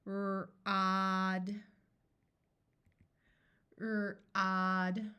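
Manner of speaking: R-odd said slowly, the R held apart from odd; slow segmented word. Shown as a demonstration. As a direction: stretch R-odd into two slow parts. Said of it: "I will say the word slowly".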